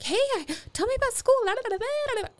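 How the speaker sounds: breezy la-la-la parent ignoring the mood; oblivious chirpy parent. Retold or imitated. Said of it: "You're not like".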